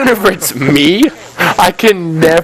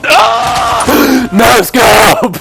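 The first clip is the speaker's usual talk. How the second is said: excited shout; 8 semitones higher, much slower than usual. Real, not acted. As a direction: yell it like a highlight clip. Excited shout at a no-scope kill.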